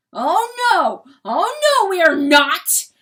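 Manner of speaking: high, loud, disgusted denial; revolted outburst. A narrator's reading. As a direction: shout it back with revulsion. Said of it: "the cock growls with revulsion".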